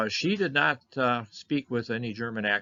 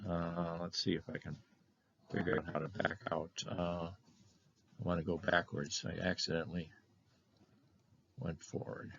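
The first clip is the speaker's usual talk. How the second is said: muttering to himself; 3 semitones lower, 8 dB quieter than usual. Real, not acted. Fumbling with the slides, muttering.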